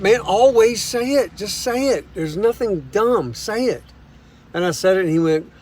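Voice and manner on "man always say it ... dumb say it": higher, slow encouraging urge. Retold or imitated. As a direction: nudge him gently to speak up. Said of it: "he said".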